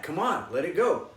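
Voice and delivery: ordinary talk, nothing marked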